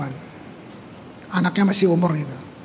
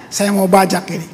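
usual speaking voice

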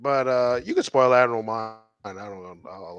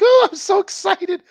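high-pitched mock excited squeal; excited woman; 4 dB louder than usual. Imitating a woman excited to go to bed, mock squeal. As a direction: play her giddy, pitched way up. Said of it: "She's like".

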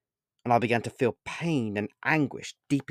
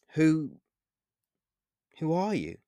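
higher, hesitant question; nervous; 5 semitones higher than usual. A narrator's reading. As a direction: falter on the first word, nervously. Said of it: "I asked nervously".

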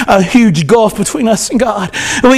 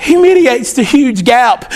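ordinary delivery

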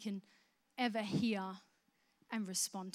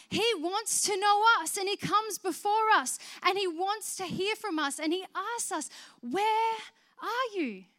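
higher and louder, impassioned; fervent preaching; 7 semitones higher, 4 dB louder than usual. Acted. Preacher telling the congregation God seeks them, impassioned. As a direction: preach it out with rising passion.

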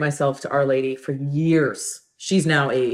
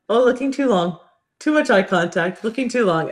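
higher, playful panic; mock flustered; 7 semitones higher than usual. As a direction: act out awkwardly looking away. Acting out flustered avoidance of eye contact.

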